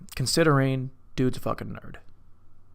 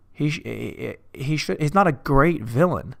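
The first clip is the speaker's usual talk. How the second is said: ordinary talk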